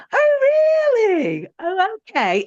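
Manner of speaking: high, airy mock surprise; bright unfazed. Client parrying hurtful remarks, mock brightly unfazed. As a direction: brush it off sweetly, imitating her. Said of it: "she went".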